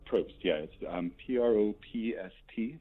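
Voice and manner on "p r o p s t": spelled letter by letter, higher pitched. Shown as a demonstration. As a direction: say P R O P S T as separate letters. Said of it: "but it is pronounced".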